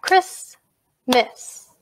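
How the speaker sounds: "Christmas" in two separate beats; split into syllables. Shown as a demonstration. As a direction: say "Christmas" with a pause between syllables. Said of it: "Clap that with me now".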